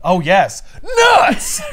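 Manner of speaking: high, loud mock shout, the last word leaping up; mock yell. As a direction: yell it like a cartoon villain's catchphrase. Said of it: "should have yelled his old thing".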